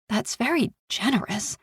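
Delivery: quiet, pointed stress on generous; disapproving emphasis. A narrator's reading. Mother to her ex, disapproving of his gift. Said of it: "Anne's emphasis on the last word spoke to her disapproval".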